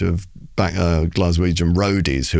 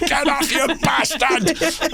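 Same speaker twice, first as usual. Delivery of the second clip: crowd heckle; slower than usual. Retold or imitated. Hostile crowd heckling the poet.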